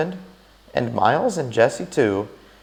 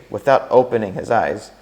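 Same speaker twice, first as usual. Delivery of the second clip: plain reading voice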